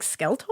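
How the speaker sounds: soft, questioning "skeleton" as "skel-tone"; puzzled echo of accent. Retold or imitated. Baffled parent repeating the child's word, "skeleton" as "skel-tone". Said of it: "this little accent with it".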